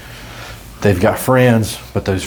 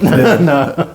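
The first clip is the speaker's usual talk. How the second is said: normal talking voice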